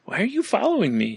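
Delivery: high, soft worried question; nervous kid voice. Pretend. Kid asks counselor.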